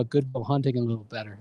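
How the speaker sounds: ordinary delivery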